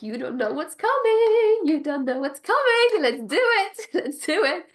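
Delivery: high, loud giddy squeal, laughing at the end; buzzing excitement. Retold or imitated. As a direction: squeal it like you can't contain yourself. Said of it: "I'm buzzing for them".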